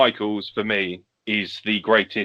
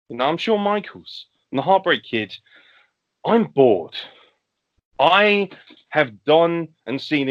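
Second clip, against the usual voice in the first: weary champion promo; 5 semitones higher than usual. Retold or imitated. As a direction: play a bored legend on the mic. Voicing Shawn's bored promo.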